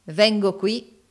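ordinary delivery

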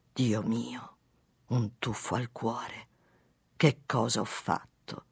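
slow, low, soft inner thought; hushed dread. A narrator's reading. Father realising what he has done, hushed dread. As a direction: sink into a quiet, heavy realisation. His guilty inward dread.

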